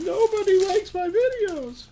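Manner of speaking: very high mock-weepy whine; imitating Lisa crying. To viewers, teasing Lisa at three a.m. over her videos, high weepy whine. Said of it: "sitting there crying, going".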